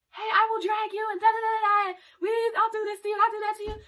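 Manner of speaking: high-pitched mock threat; playful arguing. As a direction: squabble in a high, joking voice. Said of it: "got into a little funny argument".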